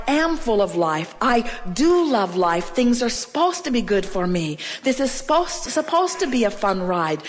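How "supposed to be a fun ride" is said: normal talking voice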